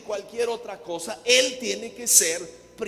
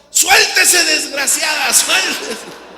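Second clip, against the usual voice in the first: much higher, louder full yell; shouting; 8 semitones higher, 10 dB louder, slower than usual. Retold or imitated. Two men below yelling up at the mother-in-law, loud shout. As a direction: cheer him on at the top of the voice. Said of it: "empezaron a gritar".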